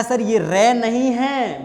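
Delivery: high, loud and slow, every word of ये रे नहीं है spaced out; slow emphatic drill. Shown as a demonstration. Teacher modelling the answer for students, slow and loud. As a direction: drill it slowly so the class repeats. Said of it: "आपको कहना है".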